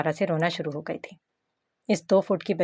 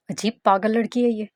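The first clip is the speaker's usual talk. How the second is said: ordinary delivery, nothing marked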